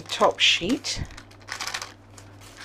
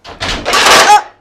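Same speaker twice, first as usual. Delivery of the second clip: startled cry; 13 semitones higher, 12 dB louder than usual. Real, not acted. Something goes wrong in oven.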